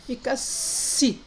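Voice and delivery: "si" said slowly with a soft s; si sounded out slowly. Shown as a demonstration. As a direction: draw out "si" with a hissing s. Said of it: "Juntando o C com I".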